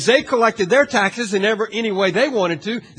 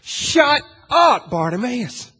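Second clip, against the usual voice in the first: higher, louder snap that drops on the name; harsh shut up; 5 semitones higher, 5 dB louder, slower than usual. Pretend. Crowd silencing the blind beggar, a sharp rebuke. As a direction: shut him down, then spit out the name. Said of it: "They use those words".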